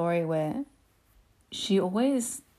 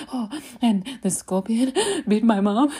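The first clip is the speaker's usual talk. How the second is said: retold panicked explaining; 5 semitones higher, 4 dB louder than usual. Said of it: "I was trying to explain".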